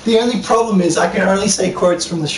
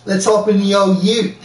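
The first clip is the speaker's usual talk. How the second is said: slightly raised, put-on Australian accent; mock Australian accent; 4 semitones higher than usual. Suggesting a line for the game character, mock Australian. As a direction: ham up the Australian a little. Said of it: "You should say".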